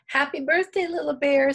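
high, loud birthday greeting; lively storybook Hen greeting; cheerful greeting. A narrator's reading. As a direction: call it out high to the birthday bear.

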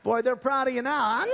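ordinary delivery

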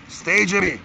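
high, loud command to the dog; shouted command. Real, not acted. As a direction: shout at Jimmy to stay put. Ordering the dog to stay.

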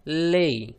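ले as l plus long e, drawn out; slow, held ले; blended syllable demo. Shown as a demonstration. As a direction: model ले for learners. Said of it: "बन गया".

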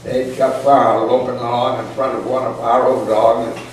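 ordinary delivery, nothing marked

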